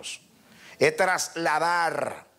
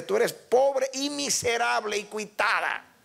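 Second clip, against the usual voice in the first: high, stern rebuke; Jesus rebuking; 6 semitones higher than usual. Acted. Jesus to the church at Laodicea, a stern rebuke. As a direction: scold the blind church sternly. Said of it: "Y Jesús le dice".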